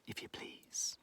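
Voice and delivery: soft whispered afterthought; tiny whisper; whispered tag. Acted. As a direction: breathe it out as a secret.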